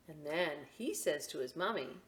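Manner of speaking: ordinary delivery, nothing marked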